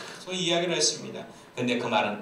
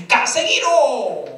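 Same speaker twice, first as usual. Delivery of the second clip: high, loud drawn-out yell; shouted command; 12 semitones higher, 11 dB louder, much slower than usual. Acted. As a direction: bellow it slowly like a platoon leader under shelling. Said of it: "소리를 쳤습니다".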